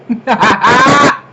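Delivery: a loud burst of laughter. Real, not acted. Gushing about the show, then a loud laugh.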